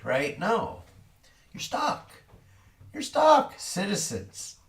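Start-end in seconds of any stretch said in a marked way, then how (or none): 1.5-2.1 whispered. Acted. Repeats verdict in contrasting ways. 2.9-3.5 "You're stuck" yelled much higher and louder; shouting "stuck" at the struggling bank; shouted. Acted. Yelling the verdict at the struggling banks, a much higher shout. Repeats verdict in contrasting ways.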